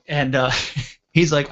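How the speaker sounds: usual speaking voice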